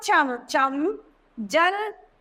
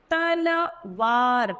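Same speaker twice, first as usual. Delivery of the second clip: तलवार drawn out slowly syllable by syllable; slow syllable reading; much slower than usual. Shown as a demonstration. Teacher modelling तलवार slowly. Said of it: "इन उदाहरण को पढ़ते हैं हम".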